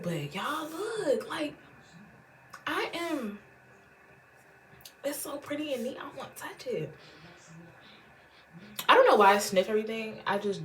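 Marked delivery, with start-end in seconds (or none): 0.0-6.9 high, soft and awed, sinking to a whisper; awed hush. Real, not acted. To viewers over the pretty palette, a high awed whisper. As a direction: marvel under your breath at it.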